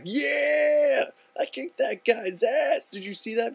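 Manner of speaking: loud, high, hammed-up victory yell; triumphant. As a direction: crow to onlookers about winning, drawn out. Triumph after man drops down.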